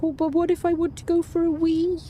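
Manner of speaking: high squeaky pet voice; squeaky cat voice. Pretend. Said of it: "you're like".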